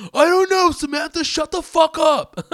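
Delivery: high, loud, slow snapping; hammed-up squeaky snap at her; mock boyfriend. Jason snapping at Samantha in stuck car, high squeak. Mocking couple fighting in car.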